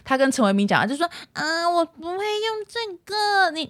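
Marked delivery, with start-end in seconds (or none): 1.3-3.7 put-on high, slow, babyish pleading; cutesy whiny girl. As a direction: act the coy clingy girl. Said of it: "那女生就很奶在撒娇".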